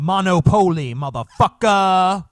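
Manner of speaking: loud, high, drawn-out exclamation; mock triumphant shout. Host cheering on a monopoly in jest, mock triumphant shout. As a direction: belt it out slowly with playful swagger. Mock board game battle cry.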